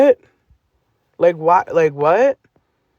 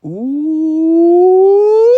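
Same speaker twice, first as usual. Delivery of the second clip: mock ooh; 12 semitones higher, 7 dB louder than usual. Real, not acted. Reacting to screenshot.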